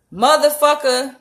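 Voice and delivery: the swear word blurted out loud; punchy swear. Shown as a demonstration. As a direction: hit motherfucker hard, like a slap. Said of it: "when you put motherfucker in there".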